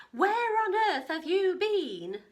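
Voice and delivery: high, loud, slow worried scolding; Grandma demanding. A narrator's reading. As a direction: call out for the missing girl. Grandma scolds returning Katie.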